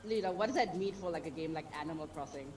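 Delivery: usual speaking voice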